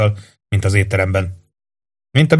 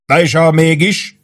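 shouted question from the crowd; 7 semitones higher, slower than usual. A narrator's reading. A soldier shouts his worry at sergeant Warden, raised call. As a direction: yell it over the crowd. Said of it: "kiáltotta valaki".

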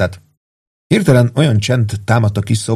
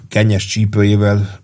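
ordinary delivery

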